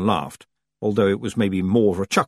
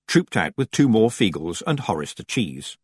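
ordinary delivery, nothing marked

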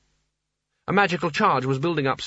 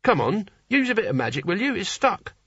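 higher voice, egging someone on; urging Nigel; 7 semitones higher than usual. Acted. Nigel asks the wizard for magic, urging him on. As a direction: play an impatient novice hero.

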